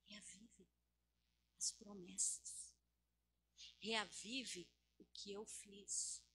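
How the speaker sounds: gentle, grave appeal from the pulpit; hushed preaching. Real, not acted. Hushed prophetic exhortation.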